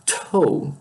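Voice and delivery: "Toe" opened with a puff of air; aspirated T. Shown as a demonstration. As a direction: let extra breath follow the T of "Toe". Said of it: "ele tem mais ar".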